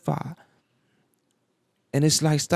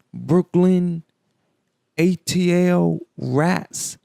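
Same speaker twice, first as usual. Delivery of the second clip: slow, emphatic comedian impression, word by word; Cat Williams; 2 semitones higher, much slower than usual. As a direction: spell it out with long pauses between words. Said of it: "in my Cat Williams voice".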